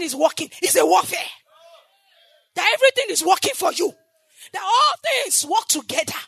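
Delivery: loud, high, full-force preaching; fiery preaching. Acted. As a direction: preach it out to the whole church.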